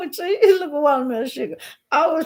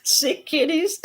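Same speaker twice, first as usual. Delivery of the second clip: ordinary talk, nothing marked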